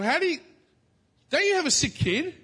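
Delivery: high, loud, stunned question; high incredulous neighbour. Retold or imitated. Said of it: "He's like".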